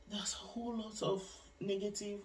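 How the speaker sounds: hushed confiding whisper. Real, not acted. Telling viewers the hostel's bad reputation, whispered. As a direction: lean in and whisper the gossip. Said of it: "whispered aside about hostel".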